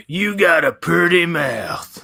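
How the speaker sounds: hammed-up Texas drawl; Texas accent impression. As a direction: lay on a thick Texas drawl, leering. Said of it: "I can do father's accent".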